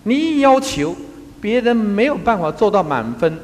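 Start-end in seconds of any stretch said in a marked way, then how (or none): none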